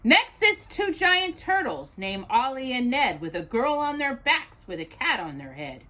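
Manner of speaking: plain reading voice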